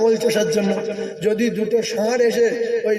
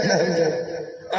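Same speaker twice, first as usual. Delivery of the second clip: sheepish denial; 4 semitones lower, 4 dB quieter, slower than usual. Acted. Preacher voices the evasive answerer.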